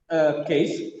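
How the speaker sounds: usual speaking voice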